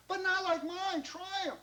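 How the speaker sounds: very high, pleading protest; eager insistence. Acted. John protests to Mister Bischoff, insistent. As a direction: burst out keenly, insisting.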